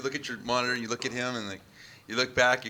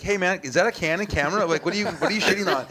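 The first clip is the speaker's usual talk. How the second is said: higher, louder, chummy question, laughing through it; mock friendly gearhead; 7 semitones higher, 6 dB louder than usual. Videographer mocking the guard's sudden camera chat, higher and louder with a laugh. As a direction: ham up the guy suddenly chatting about cameras.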